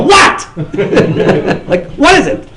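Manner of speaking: high, loud "What?"; mock bold challenge; loud demanding. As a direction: blurt it out bluntly. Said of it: "I go".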